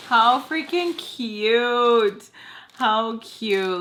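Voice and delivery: ordinary talk, nothing marked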